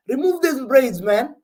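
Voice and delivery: exasperated fan yelling; frustrated yell. Real, not acted. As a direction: bark it at the screen. Yelling at a defender.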